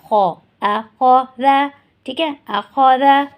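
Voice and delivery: very slow, stressing the heavy خ in اخذا; heavy kh demo. Shown as a demonstration. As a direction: drag out خو then اخذا slowly. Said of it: "یہاں پہ صرف اور صرف کیا موٹا ہوگا". (اخذا میں خ کو موٹا اور آہستہ)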